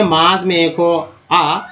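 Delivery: मास read slowly, the vowel आ drawn out; slow down to show आ in मास; slow demo. Shown as a demonstration. Teacher explaining vowel sounds, slow and deliberate. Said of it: "जैसे middle में ए को आ बोलते हैं".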